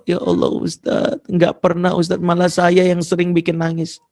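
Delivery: tearful confession. Acted. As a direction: confess guilt as if through tears. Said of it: "malah dia nangis".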